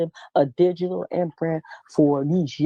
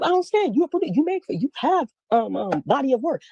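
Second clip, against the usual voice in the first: imitating him much higher-pitched and baffled; mock confused doctor; 7 semitones higher than usual. Woman mimicking the doctor's baffled reply, high-pitched. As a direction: play the doctor, pitched way up in disbelief.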